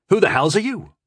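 high, forceful demand; indignant, insistent challenge. A narrator's reading. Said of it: "he demanded".

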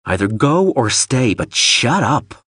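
slow, weary exasperation; exasperated sigh. Acted. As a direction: say it with a heavy sigh. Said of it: "sighing heavily".

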